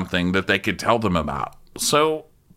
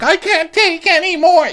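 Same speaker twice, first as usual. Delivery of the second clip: much higher, louder mock sobbing wail; fake sobbing; 6 dB louder than usual. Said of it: "Jen's fake sobbing".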